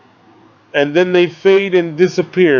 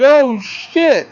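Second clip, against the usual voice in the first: higher, startled thug voice; a thug's sudden alarmed curse; thug quote; 7 semitones higher than usual. Retold or imitated. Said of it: "he's like".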